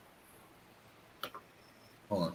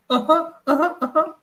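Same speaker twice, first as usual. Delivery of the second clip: mock Siri "Uh-huh", some takes jumping much higher; imitating Siri; 14 semitones higher, 3 dB louder than usual. Mocking Siri's reply to friends, imitating its voice. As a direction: parrot the assistant's chirpy reply. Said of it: "and it goes, "Mm-hmm".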